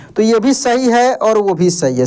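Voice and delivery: ordinary delivery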